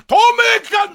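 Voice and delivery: very high shouted catchphrase; mock heckle. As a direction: holler it at the screen, hammed-up. Yells it as if watching at home.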